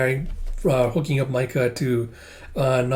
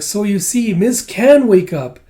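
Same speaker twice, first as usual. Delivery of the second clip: high, strained little voice, louder; pleading like an anxious small creature; Kippa's voice; 8 semitones higher, 7 dB louder than usual. Retold or imitated. Kippa begging Master Ren to help Miss, high pleading voice. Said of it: "And Kippa's like".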